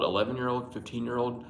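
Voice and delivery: normal talking voice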